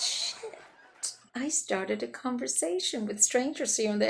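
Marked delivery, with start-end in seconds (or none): 0.0-1.1 breathy under-the-breath curse; whispered curse. Retold or imitated. Embarrassed after stranger's reply, whispered curse. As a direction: hiss it to yourself, caught out.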